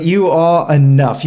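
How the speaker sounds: you are enough in a loud, hammed-up English accent. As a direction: say it like a cheery English mate. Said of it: "Can you do it in an English accent?".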